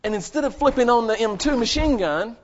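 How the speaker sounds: ordinary talk, nothing marked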